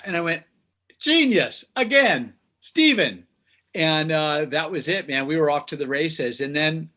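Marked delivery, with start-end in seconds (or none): 1.0-3.3 high, loud cry of approval; excited exclamation. Retold or imitated. Songwriter cheering his partner's intro riff, excited high shout. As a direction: burst out delighted, punching each word.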